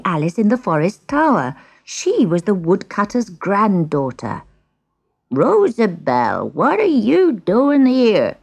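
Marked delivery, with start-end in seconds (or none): 5.3-8.4 put-on slow, high-swooping old man's call; acted shout. A narrator's reading. Old man finds Rosabelle in the kitchen, acted astonished shout. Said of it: "shouted the old man".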